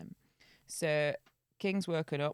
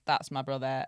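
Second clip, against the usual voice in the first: put-on Southern Welsh accent; 3 dB louder than usual. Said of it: "I can only do a Southern Welsh accent".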